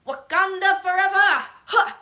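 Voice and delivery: high, loud chanting shout; hyped war cry. Retold or imitated. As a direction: bellow it like a rallying cry. Re-enacts own hyped reaction to scene.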